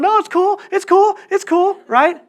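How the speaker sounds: very high panicky pleading; imitating a scared backdown. Bully pinned by Josh, begging in high panic. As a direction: squeak it out like a scared kid. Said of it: "the guy's going".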